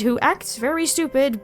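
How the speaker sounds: ordinary talk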